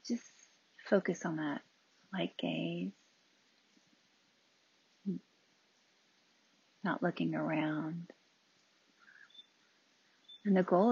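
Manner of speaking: calm breathing cues with long silences; unhurried meditation leader; guided meditation. Real, not acted. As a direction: guide each breath, leaving room after it.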